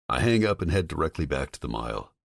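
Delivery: ordinary talk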